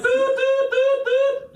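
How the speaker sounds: very high chirpy doot noises; high mock beeps. Mimicking the gadget as he hands it to Dan. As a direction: beep it like a cheap gadget.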